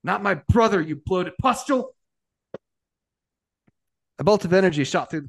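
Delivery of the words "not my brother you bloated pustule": high, strained battle roar. A narrator's reading. Brother roaring at the enemy over the vox, outraged roar. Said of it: "Geralt heard a roar from the Vox".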